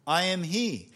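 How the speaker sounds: retold ringing answer; bold declaration. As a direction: announce it with authority. Jesus's powerful declaration retold.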